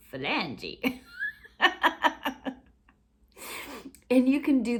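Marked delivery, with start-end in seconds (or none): 0.1-1.0 "Phalange" again with a curious rising end; "Phalange" ending on a questioning rise; playful phalange. Shown as a demonstration. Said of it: "talking about the word phalange". 1.0-2.5 high giggling laugh; delighted laugh. Real, not acted. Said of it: "laugh after odd word".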